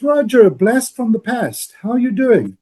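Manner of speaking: lightly acted surprise at an old friend's call; pleasantly surprised. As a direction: greet a long-lost friend out of the blue. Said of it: "And Amy goes".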